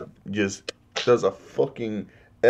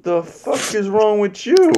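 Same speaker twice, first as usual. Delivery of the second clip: angry Kong; 9 semitones higher, 3 dB louder than usual. Retold or imitated. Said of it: "Kong's just like".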